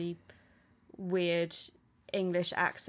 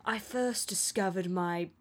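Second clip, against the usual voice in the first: lightly put-on posh, French-tinged accent, slowing down; a hint of posh French-accented English; posh, slightly French. Film heroine telling her story, lightly imitated posh French accent. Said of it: "I'm better at very posh, slightly French accents".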